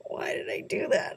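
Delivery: hushed self-reproach; whisper. Real, not acted. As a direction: whisper it to yourself, mock-tragic. Mock despair at skipped autograph.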